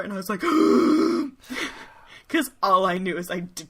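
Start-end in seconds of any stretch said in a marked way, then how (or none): 0.5-1.8 loud, sharp mock gasp; alarmed gasp. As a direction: suck in air in comic horror. Retold dread at doorstep.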